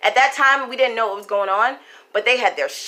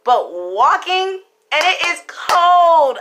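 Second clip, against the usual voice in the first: indignant; 6 semitones higher, 4 dB louder than usual. Retold or imitated. Outraged quoted complaint, retold.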